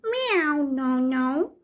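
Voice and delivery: high meowing refusal; cat voice. Acted. Cat turning down the stick, high meowing no. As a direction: meow it like a cat, then say no. See what the cat says.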